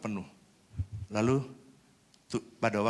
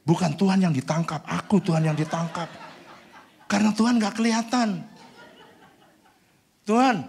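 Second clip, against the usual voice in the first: high and loud, laughing through the words; laughing plea; 10 semitones higher, 11 dB louder than usual. Retold or imitated. Pastor tells God he'll be arrested, high, loud and laughing. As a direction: joke nervously with God, laughing. Prayer, laughing at own fear.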